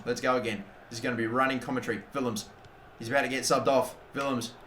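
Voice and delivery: usual speaking voice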